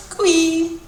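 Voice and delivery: high-pitched, cute cooee; cute little call. Shown as a demonstration. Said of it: "cute little kind of".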